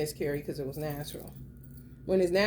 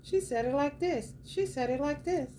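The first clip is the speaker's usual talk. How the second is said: high mock voice of the lady, much higher; mocking Divine Femme; 9 semitones higher than usual. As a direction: pitch it way up to mimic her. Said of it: "How did she say it?".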